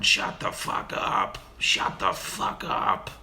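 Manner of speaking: slow, voiceless parrot rasp; slow raspy bird repeating the phrase; parrot mimicry. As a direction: croak it out over and over like a talking parrot. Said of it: "Ziggy would sit back there going".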